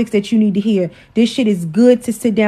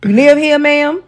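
mock confrontation; 5 semitones higher than usual. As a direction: playfully call him out. Teasing husband for reading her words.

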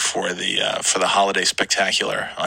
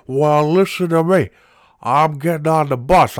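put-on angry old man prank caller; 5 semitones higher than usual. Prank caller as a furious old man demanding his cards. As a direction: rant like a cranky old customer on the phone. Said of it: "the angry old diecast cars guy".

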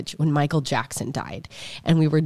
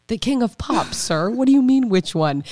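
higher, pointed "what do you mean"; incredulous retort; 6 semitones higher than usual. Retold or imitated. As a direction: correct the pharmacist in disbelief. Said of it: "we're like".

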